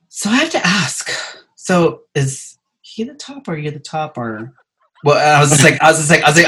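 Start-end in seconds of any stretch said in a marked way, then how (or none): 0.1-4.5 imitated prying mom. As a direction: play mom easing into a nosy question, voice raised high. Mom's awkward prying question, acted out.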